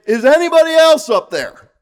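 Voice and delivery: high, loud plea for another answer; doubting shout. Retold or imitated. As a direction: cry out like the man on the cliff. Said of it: "we're saying".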